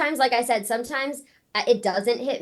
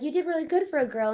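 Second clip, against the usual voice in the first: lightly voiced patronizing man, quick and higher; a hint of a patronizing fan, quick and higher; mocking the condescending guy; 6 semitones higher, faster than usual. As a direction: toss it off like a smug compliment. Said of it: "so he's like".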